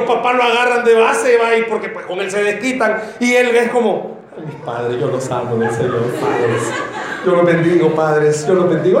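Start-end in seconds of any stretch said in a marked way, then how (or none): 4.4-9.0 hammed-up sweetness toward abusive parents; mock-holy whining child; pious child. Child mocking pious acceptance of parents.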